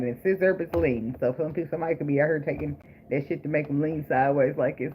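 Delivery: usual speaking voice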